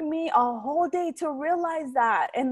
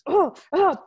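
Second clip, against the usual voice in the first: short, loud frustrated grunts, pitched up; angry grunt; 6 semitones higher, 7 dB louder than usual. Retold or imitated. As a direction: grunt as if slamming things away. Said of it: "just in an angry mode".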